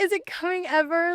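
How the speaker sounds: put-on high, plaintive small-boy voice; little shrimp kid. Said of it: "the little shrimp that hasn't grown".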